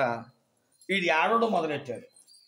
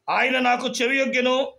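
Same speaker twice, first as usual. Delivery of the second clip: raised, louder recitation of a psalm; scripture declamation; 5 semitones higher, 4 dB louder than usual. Real, not acted. Preacher quoting a psalm to the congregation, declaiming. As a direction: proclaim the verse in a high, carrying voice.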